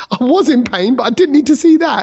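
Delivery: high laughing impression of a disgusted friend; mock pained friend, high and laughing; laughing mock squeal. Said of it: "He's like".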